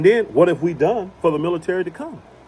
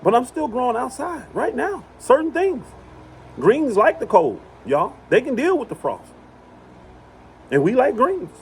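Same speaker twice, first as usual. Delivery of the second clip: higher, delighted boast; excited bragging; 10 semitones higher than usual. Real, not acted. To viewers, proud about greens in the cold, high excited. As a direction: brag about growing in November.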